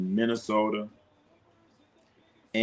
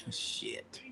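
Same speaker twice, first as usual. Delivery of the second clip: whispered curse; 17 dB quieter than usual. Real, not acted. Muttered curse after laugh.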